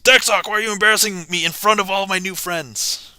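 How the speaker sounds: high-pitched mock complaint; mock embarrassed whine. Streamer scolding deck sock before new friends, high mock whine.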